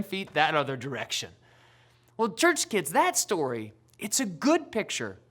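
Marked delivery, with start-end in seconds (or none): none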